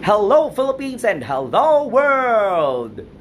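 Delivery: lively livestream host pitch; upbeat game show hosting. Acted. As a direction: hype up the viewers as quiz host. Hosts game show segment.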